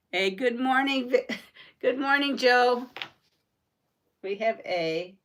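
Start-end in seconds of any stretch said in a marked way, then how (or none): none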